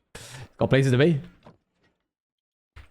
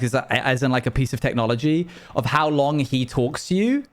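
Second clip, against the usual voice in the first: ordinary talk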